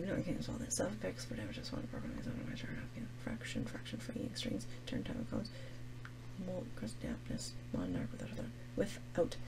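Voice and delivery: whispered, barely audible word-by-word skimming; muttering to self. Real, not acted. Teacher checking the poem for bigger words, whispering to self. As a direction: skim the words under your breath. Skims the poem under breath.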